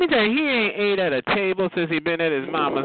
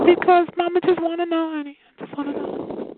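high, fussy mother voice; mama voice; 12 semitones higher than usual. Pretend. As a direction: play the doting mama. Speaks as Mama.

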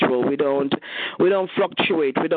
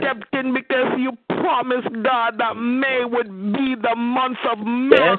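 rising, impassioned prayer voice building toward a climax; fervent prayer; 7 semitones higher than usual. Real, not acted. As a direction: church prayer leader pouring out thanks.

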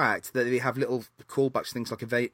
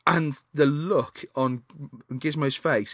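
ordinary talk, nothing marked

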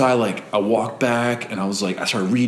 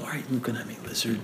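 much softer, almost whispered question; hushed to the lizard; 12 dB quieter, faster than usual. Retold or imitated. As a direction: murmur it to the lizard. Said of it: "I was like".

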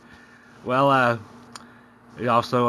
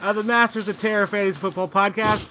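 upbeat host voice, pitched up and bright; podcast host intro; 8 semitones higher than usual. Acted. Host welcoming listeners to the fantasy football podcast, upbeat announcer read. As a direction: open the show like a radio announcer.

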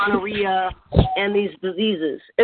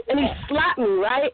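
ordinary talk

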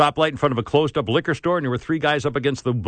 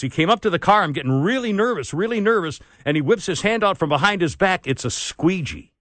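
ordinary delivery

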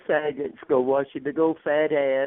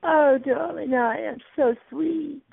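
high, sing-song camp "oh darling"; camp diva voice; 12 semitones higher, slower than usual. Pretend. Teasing the caller in a high camp diva voice. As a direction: swoon it out like a vain diva. Answers "sweet boy" in camp voice.

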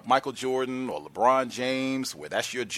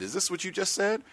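demo question; 10 semitones higher than usual. Retold or imitated. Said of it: "You can get them to sign it".

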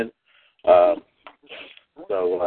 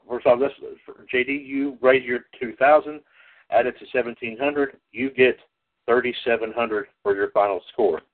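ordinary talk

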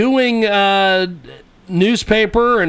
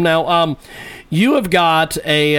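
ordinary talk

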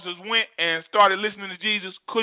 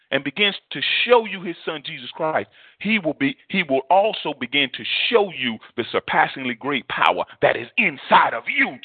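usual speaking voice

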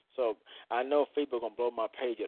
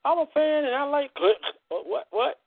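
much higher, louder mock silly voice; mock squeaky voice; 14 semitones higher, 7 dB louder than usual. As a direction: yelp it in a cartoonish falsetto. Mock voice interjection.

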